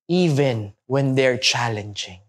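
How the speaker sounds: normal talking voice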